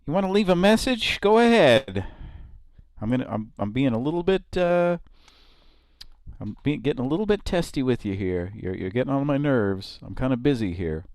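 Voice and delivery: high, loud, fast irritated receptionist; cranky nurse voice. Retold or imitated. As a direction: snap at the caller like a busy nurse. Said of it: "slightly overweight woman at a nurse's station".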